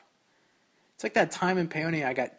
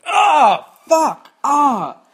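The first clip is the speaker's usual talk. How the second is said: high yelled curse with a pained cry; exclaimed curse; 12 semitones higher, 13 dB louder, much slower than usual. Retold or imitated. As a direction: shout it out as the rock bites your foot. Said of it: "and exclaimed".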